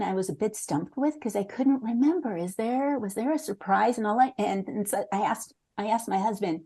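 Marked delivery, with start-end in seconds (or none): none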